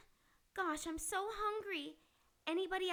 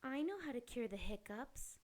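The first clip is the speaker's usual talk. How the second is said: sultry maid voice; 6 semitones lower, 5 dB quieter than usual. Acted. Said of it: "I'm not really Mistress Maid".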